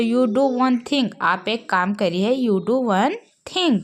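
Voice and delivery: slow, clear English phrase; drilled phrase; slow teaching model. Shown as a demonstration. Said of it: "तो".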